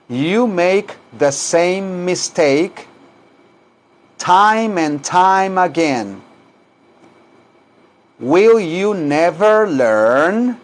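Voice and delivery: very slow, drawn-out teacher's model; slow repeat-after-me model. Shown as a demonstration. Teacher asks students to repeat, very slow. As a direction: stretch each word out for learners to copy.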